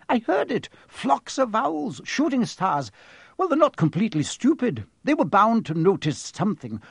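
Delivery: high-pitched, scolding professor; exasperated stern professor. Acted. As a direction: play an impatient professor airing a grievance. McGonagall's tirade voiced by narrator.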